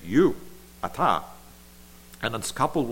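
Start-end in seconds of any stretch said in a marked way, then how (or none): 0.1-0.4 YOU punched high and loud; emphatic you. Shown as a demonstration. Preacher showing how God says it, emphatic You. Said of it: "that's how you'd say it". 0.8-1.2 ata said as AH-tah, the Hebrew for you; Hebrew word. Shown as a demonstration. Preacher giving the Hebrew pronoun, ata demonstrated. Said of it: "that's how you'd say it".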